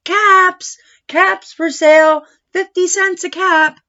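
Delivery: high, loud hawker's cry; street vendor calling. Acted. Peddler crying his wares down the street, loud calling. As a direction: call the caps out to the whole street. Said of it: "he called".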